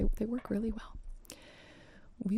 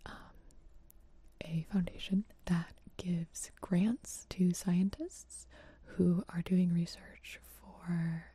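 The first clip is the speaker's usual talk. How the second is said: breathy close-mic whisper; 2 semitones lower than usual. Real, not acted. ASMR charity stream whisper.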